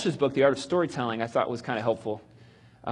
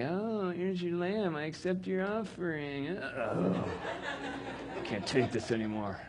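soft, slow sneering mimicry of God's voice; mocking God; 4 semitones higher, 8 dB quieter, slower than usual. Cain mocking God's praise of Abel, soft sneering mimicry. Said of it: "funny Cain".

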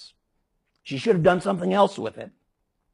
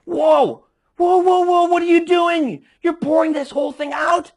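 much higher, louder, incredulous outburst; shocked outcry; 11 semitones higher, 5 dB louder than usual. Acted. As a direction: cry out like a bystander watching something outrageous. Preacher acts out shocked crowd.

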